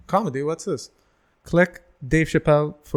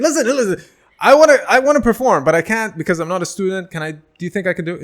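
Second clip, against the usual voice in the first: eager pleading; 6 semitones higher, 8 dB louder than usual. Retold or imitated. Begging Maz for a stage slot.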